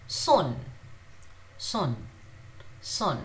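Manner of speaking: son with a very soft s; soft s demo. Shown as a demonstration. Teacher modelling a soft s in son, gentle. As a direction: breathe the s of son softly. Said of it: "Very softly when you say S".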